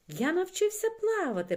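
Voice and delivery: very high, loud proud announcement; boasting. A narrator's reading. Микитка tells his friends he can swim, boastful. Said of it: "похвалився Микитка".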